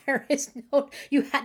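usual speaking voice

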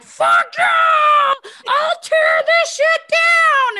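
high, drawn-out full-volume scream; re-enacted explosive rage; furious shout. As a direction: scream it slowly at the top of the voice. Acts out her furious awakening.

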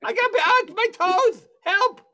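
high, loud cries for help; hammed-up panicked yelps of pain; mock screaming. Houseguest with cramping toes begging for help, mock screaming. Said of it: "He's screaming. He's got to-, toe cramps".

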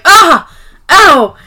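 loud, high mock yelps of pain; imitated pained cries; moans in pain. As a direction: act out two sharp pangs. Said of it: "moans in pain".

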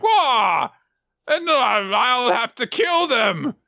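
very high, slow mock wail; hammed-up thwarted-villain cry. Dosan's mirage is blown away, villain wailing.

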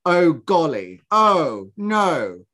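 slow, stilted mock dismay; wooden British villain. Podcaster imitating the actress's losing scene, wooden British villain. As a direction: imitate a bad actress reacting, drawn out. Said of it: "Where's your reactions?".

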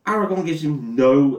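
ordinary delivery

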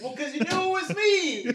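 laughing, very high-pitched protest; high put-on voice. Shown as a demonstration. Defending the failed disguise to friends, high put-on voice. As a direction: squeal it in a disguise.

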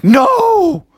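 high, loud shocked no; shocked cry. Acted. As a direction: blurt it out in alarm. Froggy shocked.

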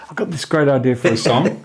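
a mildly hammed-up keen amateur pitching a song idea; eager partygoer. Songwriter mimicking an eager partygoer, lightly hammed-up. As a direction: play the eager fan with his big idea. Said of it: "they go".

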